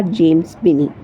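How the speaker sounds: usual speaking voice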